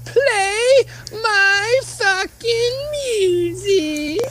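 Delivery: high, slow laughing plea. Real, not acted. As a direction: beg through laughter.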